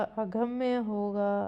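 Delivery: usual speaking voice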